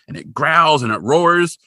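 ordinary delivery

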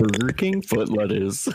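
slow exaggerated meme voice; meme voice impression. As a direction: drawl it like the viral video. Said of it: "you do it really well".

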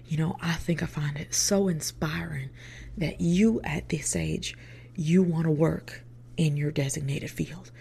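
mock-sincere Southern interviewer; thick Southern accent. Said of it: "with a thick Southern accent".

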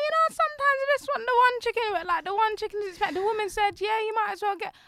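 imitating friend. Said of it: "She's like".

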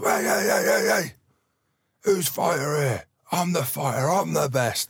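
high, loud challenge to the room; Tyson Fury. Retold or imitated. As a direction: imitate a boxer hollering for attention. Acting Tyson Fury taking over the room.

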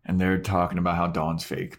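usual speaking voice

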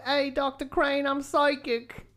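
high, hammed-up Daphne voice; Daphne impression. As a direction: pitch it up as the psychic housekeeper. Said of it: "Just imitate Daphne".

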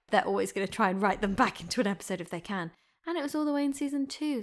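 ordinary talk

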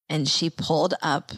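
ordinary talk

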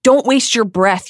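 Harry yelling at Bellatrix. A narrator's reading. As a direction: throw the words at her through pain. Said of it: "yelled Harry".

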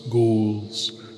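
ordinary delivery

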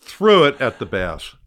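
THREW hit loud and slow; emphatic loud retelling. Retold or imitated. Fisherman telling the host about throwing his reel, loud and slow. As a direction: punch Threw hard, drawn out slowly. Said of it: "yelling and screaming".

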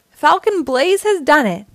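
high loud announcer victory call; triumphant crowing. A narrator's reading. The announcer crows Blaze's win to the crowd, loud and high. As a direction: trumpet the winner to the crowd. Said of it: "crowed the announcer".

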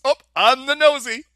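high, loud, hammed-up exclamation; imitated yell. Host quotes the charades scene Igor loved, yelled. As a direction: cry it out, quoting the film. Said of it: "And then he yells".

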